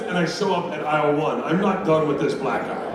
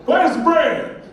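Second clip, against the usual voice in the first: high, loud shout down the aisle; indignant shopper; 12 semitones higher, 5 dB louder, much slower than usual. Acted. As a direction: holler it as a demanding customer.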